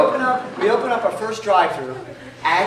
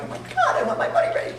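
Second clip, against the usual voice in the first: surprised. Retold or imitated. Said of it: "I'm like".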